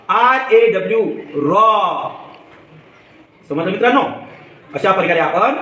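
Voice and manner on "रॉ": रॉ with a long, drawn-out aw; open aw sound. Shown as a demonstration. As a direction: model रॉ, holding the vowel. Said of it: "ए चा उच्चार अ असा करायचा". (रॉ मधला ऑ लांबवून)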